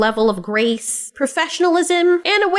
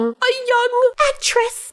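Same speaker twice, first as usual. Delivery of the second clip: high, drawn-out mock pity; mocking coddling; 9 semitones higher, much slower than usual. People excusing a grown woman, mocking high coo.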